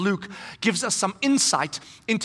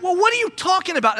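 raised, incredulous; confused challenge; 10 semitones higher, 6 dB louder than usual. Pretend. Apostles puzzled by the women, raised and incredulous. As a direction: question it skeptically.

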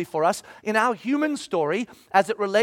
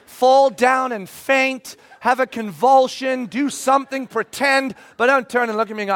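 ordinary delivery

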